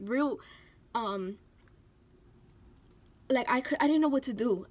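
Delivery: ordinary talk, nothing marked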